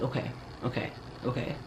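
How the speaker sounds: very soft, repeated to oneself; quiet acknowledgement. Acted. Woman absorbing her crush's answer, quietly. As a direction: take it in under the breath.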